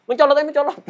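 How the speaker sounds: hammed-up latah shriek; startled latah imitation. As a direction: blurt it like someone jolted by surprise. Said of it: "Iya kan?".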